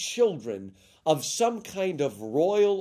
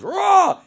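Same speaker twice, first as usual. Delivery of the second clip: high, loud roar; big imitated roar; lion roar; 9 dB louder than usual. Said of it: "And the lion goes".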